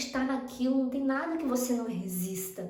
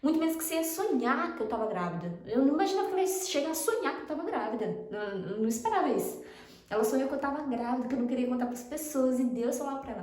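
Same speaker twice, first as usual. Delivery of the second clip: ordinary talk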